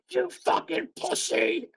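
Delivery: high, slow mocking kid's jeer; imitating a kid yelling an insult; kid heckler voice. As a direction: squeak it out like a bratty six-year-old.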